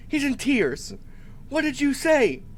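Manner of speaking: high-pitched outraged scold; incredulous scolding. Acted. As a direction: rise up high, shocked at the goat. Scolding familiar about crying friend.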